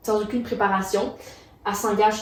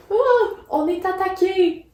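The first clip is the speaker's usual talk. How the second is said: alarmed cry; 11 semitones higher, 4 dB louder than usual. Retold or imitated. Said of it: "il est comme".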